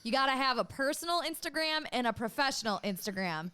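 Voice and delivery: ordinary delivery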